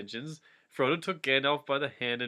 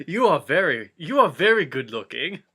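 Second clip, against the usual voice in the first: mock flattering Frodo; 3 semitones higher, 7 dB louder than usual. Frodo compliments Gandalf.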